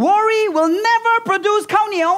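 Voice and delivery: high, fast, forceful declaration; emphatic preaching. Real, not acted. Preacher warning church that worry is useless, emphatic preaching. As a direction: drive it home quickly at a high pitch.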